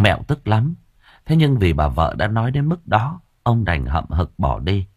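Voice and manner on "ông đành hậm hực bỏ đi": usual speaking voice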